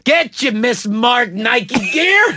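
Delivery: slow, drawn-out hawker yell; vendor call. Retold or imitated. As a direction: sell knockoff gear like a street vendor. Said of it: "by yelling it out".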